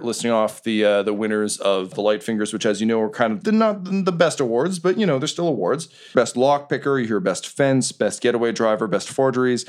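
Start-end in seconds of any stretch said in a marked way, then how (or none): none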